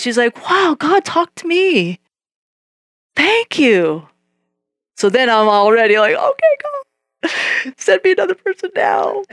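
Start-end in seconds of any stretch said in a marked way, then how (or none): 0.5-4.1 amazed. Retold or imitated. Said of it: "She was surprised". 6.1-9.2 eager. Retold or imitated. Eager after success, high voice.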